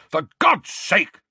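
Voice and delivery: slow, high-pitched roar; exasperated roar. A narrator's reading. Uncle seeing another owl arrive, roaring. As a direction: roar each word out. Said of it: "roared Uncle Vernon".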